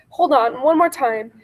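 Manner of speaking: usual speaking voice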